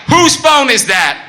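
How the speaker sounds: high, loud, slow outraged yell; furious soldier. Retold or imitated. Soldier flipping at a ringing phone, loud slow angry yell. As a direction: snap it like he's lost it. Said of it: "the guy flips".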